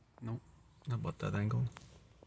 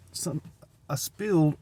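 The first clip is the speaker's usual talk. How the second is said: usual speaking voice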